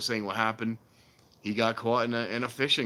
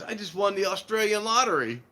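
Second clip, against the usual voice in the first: higher, eager mock announcement; old guy in commercial; 8 semitones higher, 3 dB louder than usual. Retelling old man telling wife he won, eager. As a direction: brag it innocently. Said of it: "he goes".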